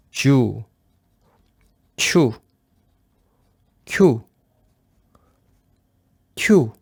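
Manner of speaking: each syllable said once, clearly; syllable drill. Shown as a demonstration. Teacher pronouncing yu-syllables once for the class. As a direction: read the syllables out one by one. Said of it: "je prononce une fois".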